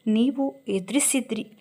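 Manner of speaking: ordinary delivery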